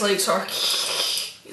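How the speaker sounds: long hissing static-like noise; voiceless sustained hiss; imitated noise. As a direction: imitate a drawn-out noise on a recording. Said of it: "there's a noise".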